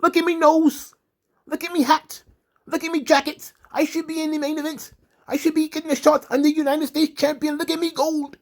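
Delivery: a hammed-up Irish show-off pleading; mock Irish accent. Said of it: "And Old Shamey was like".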